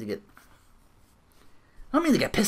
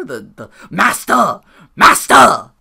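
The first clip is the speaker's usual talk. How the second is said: slow, then much louder, higher cry of Master; mocking shout; 3 semitones higher, 11 dB louder, slower than usual. Real, not acted. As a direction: bellow master in mockery. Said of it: "The, the master of the Feed Me More?".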